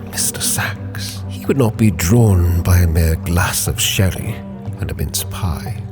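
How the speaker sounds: ordinary talk, nothing marked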